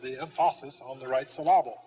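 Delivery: EMPHASIS as em-FAH-sis, SYLLABLE as suh-LAH-bul; misplaced stress joke. Shown as a demonstration. Teacher quoting a joke about accents, emphasis and syllable stressed on the wrong beat. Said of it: "or as someone has said".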